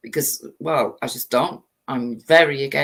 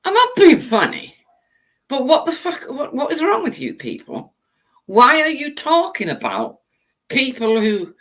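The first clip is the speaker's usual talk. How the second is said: exasperated outburst; 7 semitones higher than usual. Real, not acted. Ranting streamer erupts at online critics, exasperated. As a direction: burst out in disbelief.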